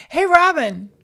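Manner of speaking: loud, high hello; loud greeting. Real, not acted. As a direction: call out a big hey. Greeting cohost theatrically.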